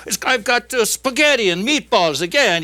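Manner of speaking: high-pitched complaining Italian; Italian worker. Acted. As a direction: grumble like a fed-up Italian workman. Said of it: "the Italian opens his container first".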